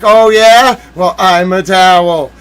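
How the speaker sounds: loud, cocky retort; defiant comeback. Pretend. Answering the Justice, loud defiant comeback. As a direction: fire back a smug, loud comeback. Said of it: "I'd be like".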